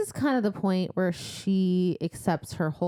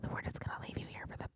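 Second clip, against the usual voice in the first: fast hissed whisper; snake monster whisper; 7 semitones higher, 8 dB quieter, much faster than usual. Pretend. Host voicing Naga leaving Feyre to die, fast whisper. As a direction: rush it out like a sly serpent.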